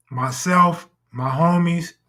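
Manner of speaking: usual speaking voice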